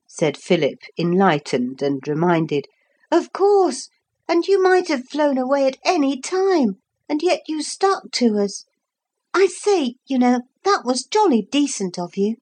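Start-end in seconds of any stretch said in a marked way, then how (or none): 3.1-12.4 put-on high, eager boyish voice, thankful; a young boy full of gratitude; grateful boy. A narrator's reading. Boy Philip speaking gratefully.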